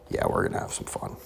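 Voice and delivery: a low breathy whisper of a sly promise. Acted. Whispering a teaser to his viewers. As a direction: hush it like a secret treat.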